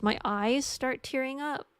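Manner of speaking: ordinary talk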